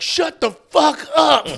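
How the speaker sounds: high, loud, slow yelled heckle; angry heckle shout. Retold or imitated. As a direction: bellow it at the comic. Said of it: "they're like".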